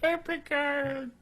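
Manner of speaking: high, slow leprechaun voice. Pretend. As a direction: say it like a tiny leprechaun. Imitates the leprechaun voice.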